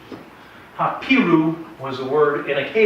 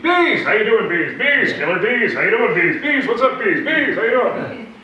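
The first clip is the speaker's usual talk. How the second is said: hearty greeting; 9 semitones higher, 6 dB louder than usual. Retold or imitated. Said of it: "They call me Bees".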